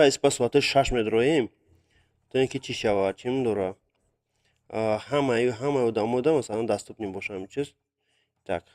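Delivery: usual speaking voice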